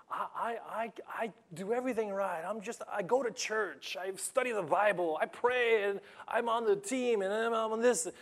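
high, pleading indignant churchgoer; indignant believer. Retold or imitated. Believer asking God why suffering came anyway. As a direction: protest you did everything right.

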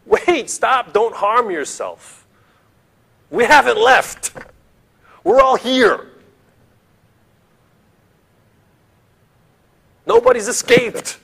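high, urgent shout, climbing to a squeal; Paul shouting. Acted. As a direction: call out desperately to stop him. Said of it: "Paul shouted".

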